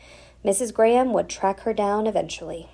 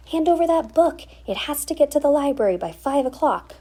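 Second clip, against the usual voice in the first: urgent demand; 8 semitones higher, 4 dB louder, faster than usual. Acted. Leader urging Duff, high loud.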